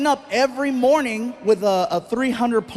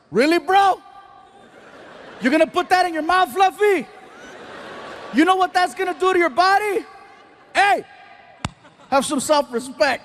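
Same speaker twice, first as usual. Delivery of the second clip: mock yelling friend; 6 semitones higher than usual. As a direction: call him out publicly like a nagging bro. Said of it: "he starts yelling in front of all the people".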